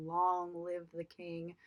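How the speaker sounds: ameaça baixa e lenta, arrastando as palavras; menacing Scar. Retold or imitated. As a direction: diga devagar, como um vilão que condena o irmão. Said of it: "in a very menacing tone".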